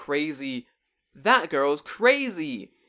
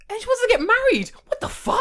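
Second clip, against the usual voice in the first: mock outraged guy; 9 dB louder than usual.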